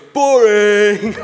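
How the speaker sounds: put-on loud, high, laughing teenage "Boring!"; huge exasperated teenage groan of "Boring!"; Kevin the Teenager. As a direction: bellow "Boring!" like a fed-up teen. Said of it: "His other catchphrase was".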